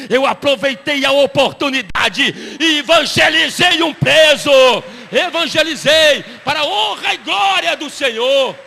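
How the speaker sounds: loud triumphant preaching proclamation; preaching shout. Real, not acted. Pastor proclaiming his triumph to the church, shouted. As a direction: shout it out to the congregation.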